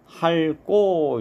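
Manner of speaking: 할꼬 with ㄹ kept and tense 꼬 drawn out; correct pronunciation. Shown as a demonstration. Teacher showing students that only ㄹ sounds, 할꼬 said deliberately. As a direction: say 할, then stretch a tense 꼬. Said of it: "이렇게 발음을 해야지".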